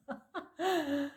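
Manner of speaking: high burst of laughter; happy laugh; bright laugh. Real, not acted. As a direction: laugh with relief. Laughing after the transmission.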